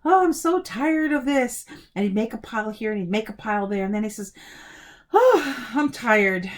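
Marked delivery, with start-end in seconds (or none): 0.0-1.6 loud, higher-pitched grumbling complaint. A narrator's reading. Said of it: "he was grumbling a lot". 5.1-6.5 tired. A narrator's reading. Boy worn out by chores.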